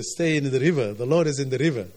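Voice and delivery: ordinary delivery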